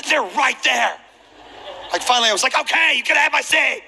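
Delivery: a put-upon plea, half joking; exasperated complaint. Retold or imitated. Seated flier complaining to God about his new neighbors, in high exasperation. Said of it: "I didn't say it like that".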